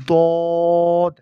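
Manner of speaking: দদ loud, rounded and drawn out; demonstrated pronunciation. Shown as a demonstration. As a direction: hold দদ long on one strong note. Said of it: "আমি যেভাবে বলেছি". (দদ জোরে লম্বা করে টেনে)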